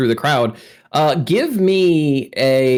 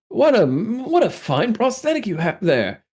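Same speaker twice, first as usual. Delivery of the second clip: sly flattery; 9 semitones higher than usual. Acted. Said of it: "And he goes".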